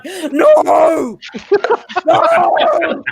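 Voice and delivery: high, loud, drawn-out wail; anguished mock cry. Told her accent sounds American, hammed-up horrified wail. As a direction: wail it out in comic horror.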